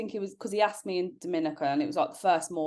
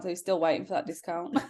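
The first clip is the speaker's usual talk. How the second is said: ordinary talk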